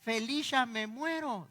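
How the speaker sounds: put-on high, slow, strained old voice; frail old man. Old man without dentures mangling his greeting, high and slow. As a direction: drag it out like a dying old man.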